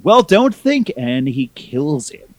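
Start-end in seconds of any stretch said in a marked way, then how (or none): 0.0-0.9 loud, high cutting retort; hammed-up menace before the kill; shrieked put-down, much louder; mock villain snap.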